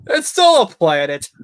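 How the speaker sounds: high, loud, drawn-out insistence; loud protest. Real, not acted. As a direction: shout it slowly in protest. Protesting that Eris still counts.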